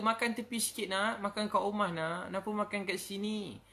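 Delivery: a mother coaxing her child, voiced high; mother's plea. Mother scolds child at roadside.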